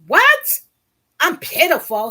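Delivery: a very high, loud "What?" then "I'm pitiful?"; outraged disbelief. Retold or imitated. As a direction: explode in shocked disbelief. Insulted by manager, shocked.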